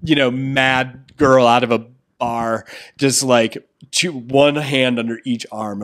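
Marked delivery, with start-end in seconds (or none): none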